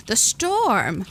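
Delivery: a touch of excitement; a little excitement. A narrator's reading. Lily guesses where the girl came from, slightly excited. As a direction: let a little excitement slip through. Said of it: "allowing a little excitement in her voice".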